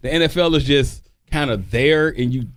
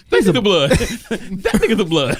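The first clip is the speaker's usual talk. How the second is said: imitating Stacy; 3 semitones higher than usual. Quoting Stacy's line from The Wood.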